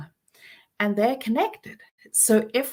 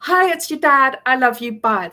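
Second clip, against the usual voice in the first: cheerful dad's call; 4 semitones higher, 8 dB louder than usual. Retold or imitated. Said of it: "All you need to do".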